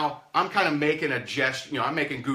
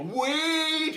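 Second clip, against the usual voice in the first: calling out. Retold or imitated. Prospect calling out to stop the call, high cry. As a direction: call them back before they hang up.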